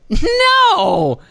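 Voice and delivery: very high, loud, slow scoffing voice; mocking boss dismissing question; condescending boss. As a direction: talk down like the question is stupid. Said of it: "he just talks to you always like".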